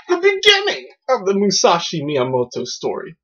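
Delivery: high dramatic title announcement; hammed-up, heightened presenter voice; mock-grand announcer.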